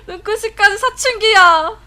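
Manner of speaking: high, loud, drawn-out cries of disbelief; stunned high wail; shocked shriek. Real, not acted. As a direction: scream it like your pet just went rogue. Shocked by pet's puberty.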